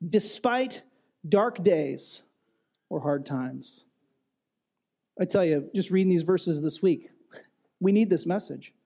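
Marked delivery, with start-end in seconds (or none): 0.1-3.8 spoken very slowly, word by word, with pauses; slow, deliberate. Shown as a demonstration. Preacher stating his main point to the church, slow and deliberate. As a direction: let each phrase land slowly, fading at the end. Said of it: "We could say it this way".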